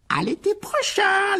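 high, drawn-out farewell shout; long ringing yell. A narrator's reading. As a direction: call it out long and loud from afar. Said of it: "leur cria Harry".

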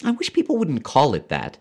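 high-pitched woman character's complaint; high, pained protest; Leora, objecting. A narrator's reading.

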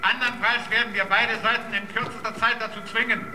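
official announcement. Acted. Protection committee orders fighters to stop, official announcement. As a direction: read out an order to all sides, unmoved.

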